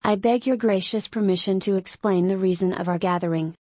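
plain reading voice

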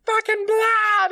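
high, slow, loud hammed-up yell; overacted outraged scream; mock shout. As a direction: drag the yell out. [name] yells about blood.

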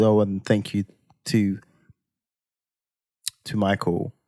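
thank-you stalling in a long pause after "to"; halting at "to" before "Michael", as if holding back a word; hesitant pause. Retold or imitated. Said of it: "what's that pause for?".

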